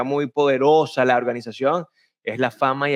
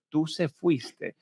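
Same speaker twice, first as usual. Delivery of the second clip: "¿Tú se fuiste?" repeated slowly and much softer, as a baffled question; puzzled echo; 7 dB quieter, much slower than usual. Retold or imitated. Teacher repeating the student's odd phrase, slow puzzled echo. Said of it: "ella comenzó a mezclar el tú".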